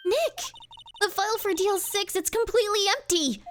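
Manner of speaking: high-pitched urgent character voice; alarmed discovery. Acted. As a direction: call out in acted shock.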